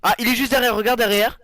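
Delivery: high, loud urgent warning; sudden scared alert; alarmed. Real, not acted. Le loup juste derrière. (alerte effrayée, haute et forte)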